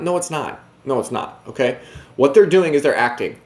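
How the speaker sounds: ordinary talk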